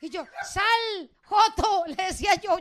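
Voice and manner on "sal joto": loud, raised-pitch command; imitated enraged holler; retold angry yell. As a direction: reenact a furious order to come out. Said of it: "yo con una rabia".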